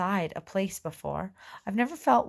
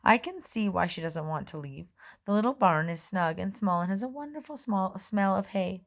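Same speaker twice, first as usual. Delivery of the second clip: ordinary delivery, nothing marked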